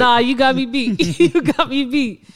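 high, loud, laughing through the words; laughing concession. Real, not acted. Woman concedes voice-note contest to co-host, laughing. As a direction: crack up while conceding. Teasing over who has more voice notes.

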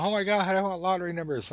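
high, loud, quick gushing; hammed-up excited fan; mock excited begging. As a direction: gush it fast like an over-eager texter. Acts out pestering texters.

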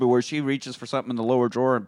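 normal talking voice

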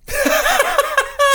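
bursts into a high giggle; a real, high-pitched laugh at his own joke. Real, not acted.

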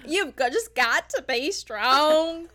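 slow, loud, hammed-up Southern drawl; mock Southern plea. Mocking the mother's TV plea in a Southern accent. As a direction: stretch it out like a weepy Southern mom. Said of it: "put on your Southern accent".

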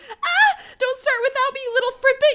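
mock shriek through laughter, rushing at the end; Moira's outburst. Co-host quoting Moira's line before the labor, hammed-up high outburst. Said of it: "Moira, where she goes".

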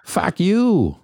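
high, drawn-out New England curse; New England accent. Acted. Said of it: "can't really do the New England".

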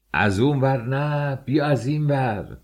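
high, slow shouted directions. A narrator's reading. Chief engineer redirecting a worker, shouting. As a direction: call it out across a building site. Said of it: "سرمهندس داد زد".

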